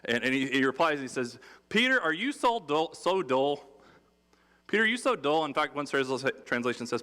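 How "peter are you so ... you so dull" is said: mock incredulous. Jesus teasing Peter for not getting it, high mock disbelief.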